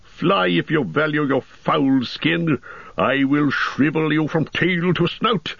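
high, slow ringing command; commanding cry. A narrator's reading. Gandalf facing the wolf captain, crying out a command. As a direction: call the wolf to heel with authority. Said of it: "he cried".

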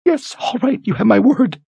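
very high, terrified voice; choked with terror. Acted. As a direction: force the words out in terror. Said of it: "choked with terror".

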